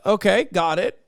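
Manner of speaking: ordinary talk, nothing marked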